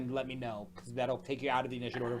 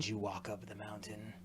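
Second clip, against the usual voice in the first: whispering goddess; 4 semitones lower, 10 dB quieter than usual. Acted. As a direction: hiss it like an ancient spirit. Goddess questions trespasser.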